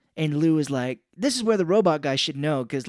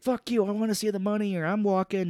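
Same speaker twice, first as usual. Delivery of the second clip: higher-pitched mock defiance; acted arguing; 6 semitones higher than usual. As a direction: retell a tough standoff ultimatum. Retold standoff over the money.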